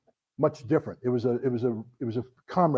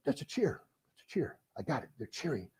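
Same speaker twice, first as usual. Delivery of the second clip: quiet aside; 14 dB quieter than usual. Retold or imitated. Realizing privately during the speech.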